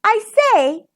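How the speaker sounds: sing-song, friendly hail; sweet calling. A narrator's reading. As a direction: call sweetly at a closed door. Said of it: "he called sweetly".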